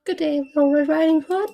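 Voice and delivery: very high, loud wolf voice; Big Bad Wolf. Wolf greets Red on the path, high creepy voice.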